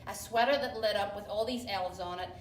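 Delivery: ordinary talk, nothing marked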